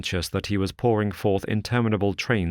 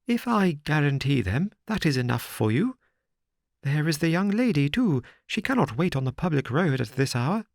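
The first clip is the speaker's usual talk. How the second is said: higher, helpless complaining gentleman; perplexed Thaddeus; 7 semitones higher than usual. A narrator's reading. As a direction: fret like a flustered little man. Thaddeus pleads.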